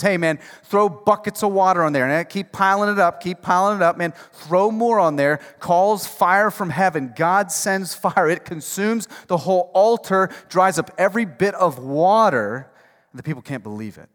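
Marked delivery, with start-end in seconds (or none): 0.0-5.4 taunting, loud and teasing; mock dare, slowed on the last line; sarcastic mocking. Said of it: "mocking them sarcastically goes". 5.6-12.7 loud, driving sermon delivery; rising revival preacher; full-force preaching. Acted.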